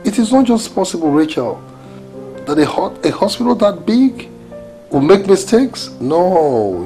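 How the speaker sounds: high-pitched, insistent disbelief; indignant protest. Acted. Spouse rejecting a hospital's excuse, indignant protest. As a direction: refuse to accept it, voice climbing.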